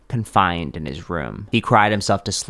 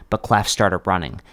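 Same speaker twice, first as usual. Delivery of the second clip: ordinary delivery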